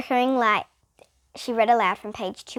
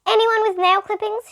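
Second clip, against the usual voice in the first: raised, brighter teacher voice; Miss Jules asking; 10 semitones higher, 5 dB louder than usual. A narrator's reading. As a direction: call out to the class, pitched up. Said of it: "she asked".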